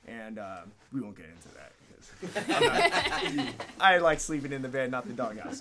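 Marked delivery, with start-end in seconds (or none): 2.0-5.6 laughing. Real, not acted. Joking about avoiding an argument story.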